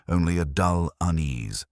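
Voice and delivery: ordinary delivery, nothing marked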